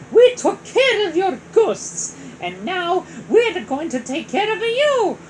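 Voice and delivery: high, loud confident taunt; confident hero. Acted. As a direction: tell him off boldly. Mario confronts Bowser.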